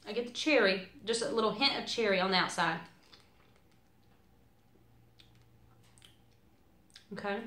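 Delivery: talking around small candy; sucking a mint while speaking; candy in mouth. Real, not acted. Seen 3: popped candy in at -1; lips purse, mouth works candy while talking.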